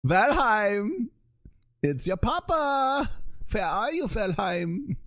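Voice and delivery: put-on high, drawn-out German-accented papa calling; hammed-up German sing-out, pitched high; movie German accent. Papa searching for Valheim, high movie German accent. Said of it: "movie version of a, of a German accent".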